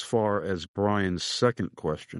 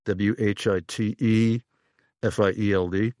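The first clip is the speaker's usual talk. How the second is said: spelled out. Shown as a demonstration. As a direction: spell it out for the listener, each letter separate. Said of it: "Whitfield is spelled like".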